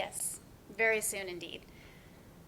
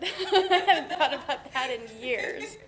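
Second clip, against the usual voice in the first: laughing; 7 dB louder, slower than usual. Acted. Laughing at shared memory.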